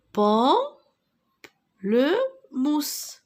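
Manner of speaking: Pamplemousse again very slowly, rising, syllables apart; slow syllable repeat. Shown as a demonstration. Teacher repeating the word for pupils, very slow. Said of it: "c'est un pamplemousse".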